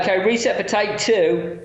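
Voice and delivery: a high call to the crew; calling out on set. Retold or imitated. Crew member calls across the set for the reset, calling out high.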